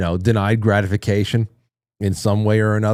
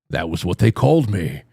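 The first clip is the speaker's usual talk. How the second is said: Gandalf; 4 semitones lower than usual. Acted. Host playing along with a chat joke, mock-grand wizard voice. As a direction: intone it like a solemn old wizard. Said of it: "Gandalf the Moist".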